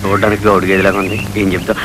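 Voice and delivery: low, hushed aside; muttered aside. Acted. Man privately grumbling about the person he is coaching, muttered aside. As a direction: drop voice low and soft, under the breath.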